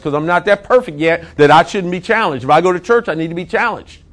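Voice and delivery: ordinary talk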